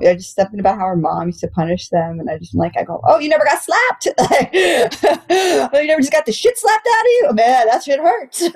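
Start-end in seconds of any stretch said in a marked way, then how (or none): 3.0-8.4 high, incredulous teasing questions; mock disbelief. Comic teasing her girlfriend who was never slapped, high and incredulous. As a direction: act stunned, half laughing.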